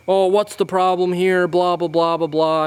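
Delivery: mock him as a high, whiny complainer; whiny dad voice. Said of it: "this high-pitched, whiny voice".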